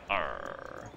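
low growled pirate arr. Acted. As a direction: growl it like an old sea dog. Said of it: "I have to say it like a pirate".

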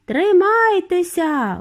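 high, long-drawn call; shouted warning. A narrator's reading. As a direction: call out to hold tight. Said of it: "гукнув містер Вонка".

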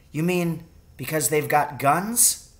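put-on a young son, keen and ready; boy's voice. A narrator's reading.

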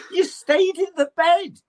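put-on asking the girl in disbelief, laughing and high; incredulous question. Laughing disbelief. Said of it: "I said".

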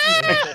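very high, loud yelp of pain. Acted. Patient crying out as bone is twisted, yelp. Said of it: "the guy's just be like".

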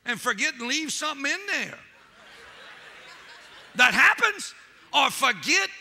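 mock the doctors while preaching; heated mocking preaching. Real, not acted. Preacher mocking careless surgeons, loud and laughing.

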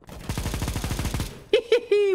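breathless high-pitched giggle; high airy laugh. Real, not acted. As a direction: laugh helplessly. Laughing at teammate.

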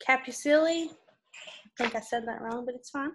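usual speaking voice

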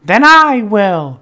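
high, loud, slow and firm; determined hen. A narrator's reading. The hen taking on the work herself, high and firm. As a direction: plant each word like a stubborn little hen. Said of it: "said the little red hen".

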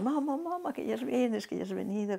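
be the little ones greeting mum at the door; imitating excited kids. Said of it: "Los chiquillos". (imitando a niños contentos, voz mucho más aguda)

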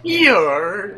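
high, loud pirate cry; pirate yar. Pretend. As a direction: bark it like a pirate. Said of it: "Talk Like a Pirate Day".

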